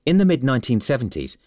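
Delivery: usual speaking voice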